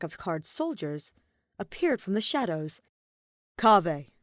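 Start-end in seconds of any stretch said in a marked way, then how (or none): 3.6-4.1 loud, firm call of the name; stern call. A narrator's reading. King calling the queen, stern and loud. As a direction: command attention sternly. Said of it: "A voice so stern".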